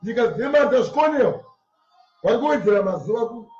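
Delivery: ordinary delivery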